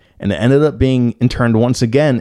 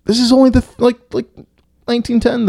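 high, baffled protest; mind blown; faster than usual. Real, not acted. Reader objecting to the old spelling, mind blown. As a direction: stumble over it in high-pitched disbelief. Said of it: "audio my mind being blown".

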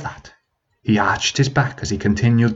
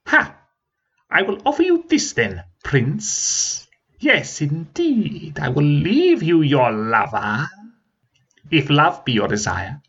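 crackling dragon laugh and offer; 5 semitones higher, slower than usual. Acted. Dragon bargaining.

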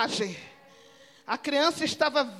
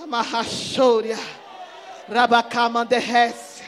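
lower, murmured tongues; speaking in tongues; 6 semitones lower than usual. Real, not acted. Preacher telling the story, murmuring in tongues. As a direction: breathe out the tongues low and inward.

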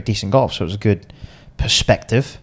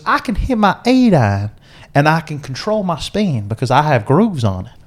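put-on southern American accent; 5 semitones higher than usual. Golfer explains his conundrum to Bruce, in a hammed-up Southern American accent. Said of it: "is [name]'s southern American accent good or bad".

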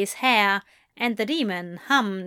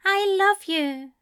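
higher, lighter angel voice; angel's reply; 11 semitones higher than usual. A narrator's reading. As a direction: answer at once, gently. Angel answers Crowley at once.

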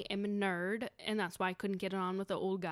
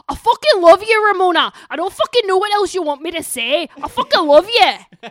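very loud, high Scottish yelling; Scottish husband impression; 9 semitones higher, 19 dB louder than usual. Husband fighting with his wife, shouted Scottish accent. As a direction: bellow it in heavy Scottish, mock-furious. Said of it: "this is my impression of him".

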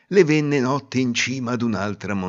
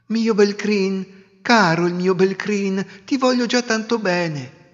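higher, slow fond coo; tender cooing; 8 semitones higher than usual. Acted. Bride caressing her dirty husband, cooing tenderly. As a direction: coo over him like a beloved pet.

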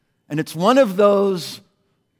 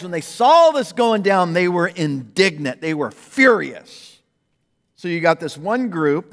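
ordinary talk

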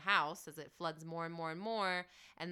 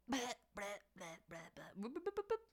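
rewind noises; 8 dB quieter, faster than usual. Pretend. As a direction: make silly tape-rewinding sounds. Imitating a tape rewinding.

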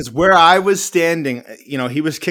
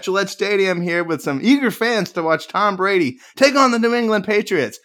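high, hammed-up broadcast announcer voice; mock TV reporter; 7 semitones higher than usual. Pretending to report from the stadium, mock TV reporter voice. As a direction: play a live sports reporter. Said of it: "I'm like".

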